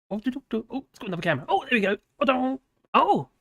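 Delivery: normal talking voice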